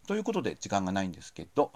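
fast, hurried wrap-up; rushing before cutoff. Real, not acted. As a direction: hurry through it, out of time. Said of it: "駆け足ですいません". (時間切れ前の早口)

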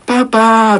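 put-on high, loud little boy's call; high, loud little boy's call to his papa; child calling papa. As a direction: call out across the food court like a small kid.

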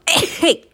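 small stifled sneeze; sneezing. Real, not acted. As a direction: sneeze out loud. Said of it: "くしゃみ出そう".

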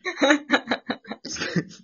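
loud, high laughter; a high, loud burst of laughing. Real, not acted. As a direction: laugh at your own blunder. Laughing at own blunder.

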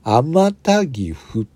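天田岐阜 read very slowly, syllable by syllable; slow reversed reading. Shown as a demonstration. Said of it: "これを逆さまにすると".

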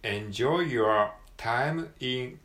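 "enjoy your time in" very slowly, every word separated; slow model phrase. Shown as a demonstration. Teacher modelling the phrase slowly for students. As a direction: spell out the phrase slowly for learners. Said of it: "Rですね".

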